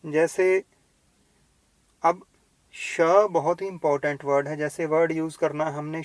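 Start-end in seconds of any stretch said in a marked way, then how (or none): none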